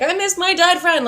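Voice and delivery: high-pitched imitation of tearful wailing; mock bawling. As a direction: wail it like a hammed-up breakdown. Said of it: "I start bawling about the randomest shit".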